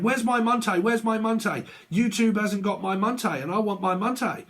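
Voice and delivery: high-pitched imitation of a ranting card reader; mock a screamed demand, pitched well up; mock screaming. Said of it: "started screaming at everybody saying".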